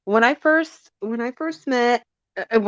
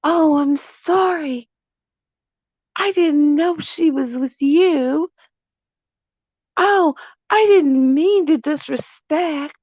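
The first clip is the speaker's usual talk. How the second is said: higher, slow, meek mock apology; 6 semitones higher than usual. Catcaller apologizing to the husband, higher meek mock voice. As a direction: shrink into a timid, higher sorry. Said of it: "he was like".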